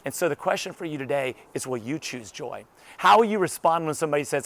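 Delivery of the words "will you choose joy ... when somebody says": usual speaking voice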